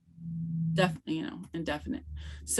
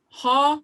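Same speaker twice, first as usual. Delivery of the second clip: "ha" pitched high and loud, going up; rising question tone; 7 semitones higher, 6 dB louder than usual. Shown as a demonstration. Teacher showing the mark for a question, rising tone. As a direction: lift the voice high on "ha". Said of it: "goes up and your voice".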